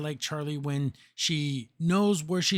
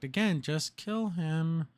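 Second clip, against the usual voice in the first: slow insistence; 4 dB quieter, much slower than usual. Real, not acted. Frustrated advice to the characters.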